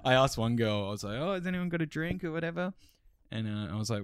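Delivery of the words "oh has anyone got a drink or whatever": high, breezy party asking; casual party voice. Retold or imitated. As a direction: ask it like a chill party guest. Asking a girl for drink.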